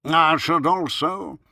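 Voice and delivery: slow, high, loud pronouncement; pompous doctor. A narrator's reading. Doctor adding to his prescription, pompous and loud. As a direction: stretch it out with grand importance. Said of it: "in a very wise tone of voice".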